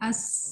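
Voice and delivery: اس with a long hissed ending; whistling s. Shown as a demonstration. Teacher showing the sound that must come, whistling س. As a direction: hold the س of اس like a whistle. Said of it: "یہ آنی چاہیے".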